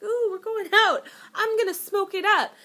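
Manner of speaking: retelling her own excitement about a smoky eye; excited. Retold or imitated. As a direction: gush about going out. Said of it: "and I'm like".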